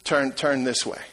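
barely above a murmur, a soft nudge; soft inner voice. Acted. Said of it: "doesn't scream and he doesn't talk very loud".

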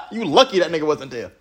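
said through laughter; laughing. Real, not acted. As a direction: laugh the words out. Laughing while talking.

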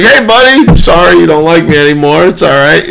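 high, loud put-on buddy greeting; mock friendly address.

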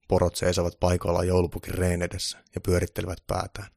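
ordinary delivery

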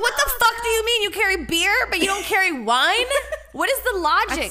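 high, loud, laughing disbelief; incredulous outrage. Retold or imitated. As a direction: squeal out the injustice. Outraged at store clerk.

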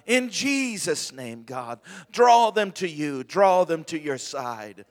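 loud, building, pleading prayer; fervent prayer. Acted. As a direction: press the plea harder each line. Prayer rising to full force.

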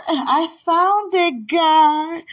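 high, loud, drawn-out cry to God; triumphant praise. Real, not acted. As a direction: call it out slow and elated. Celebrates the find again.

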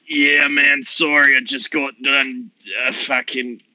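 a sleepy Aussie voice; Australian Alpha Chad. Acted. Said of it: "I just became Australian for a second".

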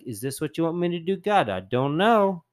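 unsure plea. Retold or imitated. A believer asking God what he wants, unsure and quick.

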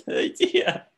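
high, stretched out through laughter; laughing hard. Real, not acted. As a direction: crack up while asking, voice shooting up. Cracking up at the idea.

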